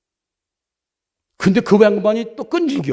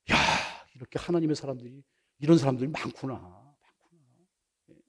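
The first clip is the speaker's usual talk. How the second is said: low, soft musing; quiet, low wonder; hushed marvelling; 8 semitones lower, 11 dB quieter than usual. Retold or imitated. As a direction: marvel under the breath, trailing away.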